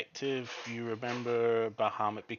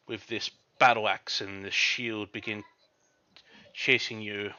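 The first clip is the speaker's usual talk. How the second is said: ordinary delivery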